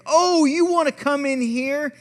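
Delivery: loud, high mock confrontation; yelled challenge. Box preacher challenging a man who approaches, yelling. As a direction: imitate a street preacher squaring up. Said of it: "He's yelling at people".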